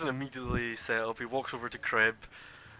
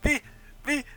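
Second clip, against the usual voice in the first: put-on much higher, eager girl voice; eager volunteer; 4 dB louder than usual. Summers volunteering to fight, eager and high. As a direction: pipe up, desperate to be picked. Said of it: "She's like".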